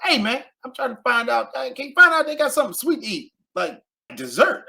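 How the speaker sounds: high excited friend asking for dessert; high-pitched eager friend on the phone; friend's voice. Retold or imitated. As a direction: raise your voice high and eager, fast. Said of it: "He's like".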